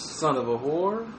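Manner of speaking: low, soft, questioning rise at the end; attempt at bass. Shown as a demonstration. Retrying the insult for a co-host, tentatively bassy. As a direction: try it deep and quiet, lifting at the end. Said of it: "You gotta have the right bass".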